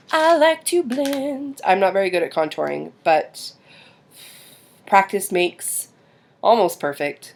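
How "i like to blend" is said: high, drawn-out sing-song; playful put-on voice. Vlogger enjoys favorite makeup part, playful sing-song.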